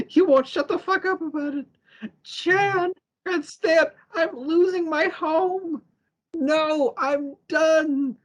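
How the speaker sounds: high-pitched retold rant; mock frustrated outburst. Charles losing his home, fed up with the others, mock outburst. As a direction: imitate exasperation, high and drawn out. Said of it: "Charles, he's just frustrated".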